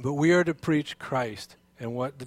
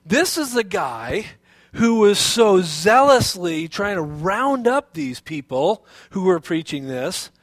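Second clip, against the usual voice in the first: astonished crowd; 3 semitones higher, 5 dB louder than usual. Retold or imitated. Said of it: "And they were going".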